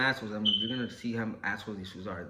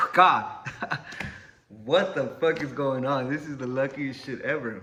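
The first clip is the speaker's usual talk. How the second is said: laughing; 5 dB louder than usual. Retold or imitated. Dog leaves, relieved.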